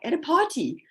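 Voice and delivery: high, disbelieving scoff at the idea; incredulous retort. Retold or imitated. Medium asked to read at a party, scoffing. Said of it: "And I'm like".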